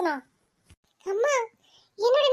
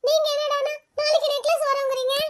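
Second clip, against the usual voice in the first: ordinary delivery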